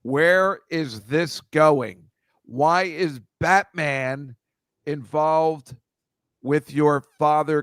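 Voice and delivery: very slow, deliberate riddle delivery with pauses; teasing quizmaster. Pretend. Host daring guests to link the photos, slow teasing quizmaster. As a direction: draw each clue out slowly and portentously.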